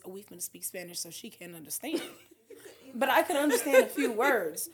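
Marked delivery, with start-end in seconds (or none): 0.0-2.0 imitating hushed talk. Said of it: "like to do this".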